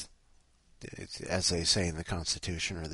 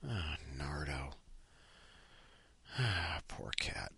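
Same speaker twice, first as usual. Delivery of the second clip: low, slow whisper; hushed dismay; 7 semitones lower, 13 dB quieter, slower than usual. Real, not acted. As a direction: sigh the pet's name under your breath. Sighs over the smelly cat.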